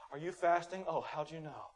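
lightly acted feeble, fading voice. Churchgoer hoping his fast gets noticed, mock-feeble and quiet. Acting a faster fishing for notice.

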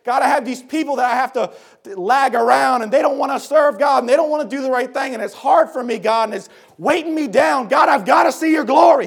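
desperate prayer rising to a yell; begging God. Retold or imitated. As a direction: build the cry from plea to shout. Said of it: "He's begging God".

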